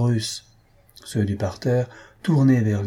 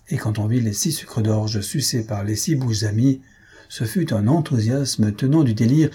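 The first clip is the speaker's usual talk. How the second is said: ordinary delivery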